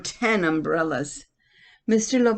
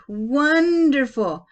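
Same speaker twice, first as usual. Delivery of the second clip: slow, drawn-out, higher and louder wonderful; delighted; 7 semitones higher, 7 dB louder, much slower than usual. Acted. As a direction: savor the joy in one long word. Grandma delighted by party.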